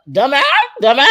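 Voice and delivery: high, loud mimicking bird; talking bird. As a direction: squawk it like a bird copying human speech. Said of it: "birds that can literally mimic human voice".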